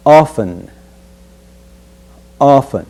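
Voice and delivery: "often" said as offen, with no t; silent t. Shown as a demonstration. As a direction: drop the t in "often". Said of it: "we will omit the sound of t".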